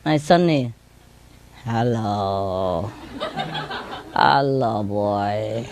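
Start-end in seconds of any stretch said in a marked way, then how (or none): 1.6-2.9 low, drawn-out sleepy hello; talking in a dream. Retold or imitated. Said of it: "they talk in a dream". 4.1-5.7 low, drawn-out sleep-talk; talking in a dream. Retold or imitated. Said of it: "they talk in a dream".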